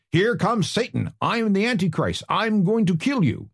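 high-pitched mock yell, no extra volume; restrained, high declaiming of a yelled threat; retold yell. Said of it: "He yelled".

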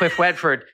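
"Cliff Redford" as "Cwiff Wedford"; mock speech impediment on "Cliff Redford"; R's said as W's. Shown as a demonstration. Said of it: "I couldn't say my R's".

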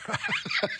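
airy burst of laughter; breathy laugh. Real, not acted. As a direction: laugh it off after the joke. Laughs at own joke.

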